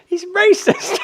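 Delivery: high-pitched, squealed through laughter; laughing mock accusation. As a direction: crack up while playfully accusing.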